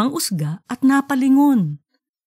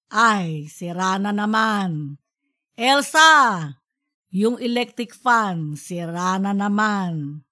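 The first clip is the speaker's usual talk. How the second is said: weary complaint; slower than usual. A narrator's reading. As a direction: sigh it out, fed up. Father annoyed broken fan. (tatay na nagrereklamo sa sirang bentilador, mabagal at pagod)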